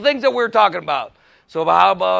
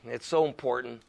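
ordinary delivery

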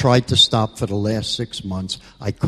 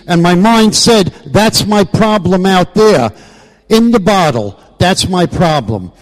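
impassioned shout; 8 semitones higher, 7 dB louder than usual. Real, not acted. Peak of impassioned AA testimony.

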